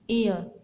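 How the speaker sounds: एयर with the final र dropped, ending in a schwa; silent R. Shown as a demonstration. Teacher shows the transcription of एयर, final R left silent. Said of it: "आर है, इसका transcription नहीं होगा".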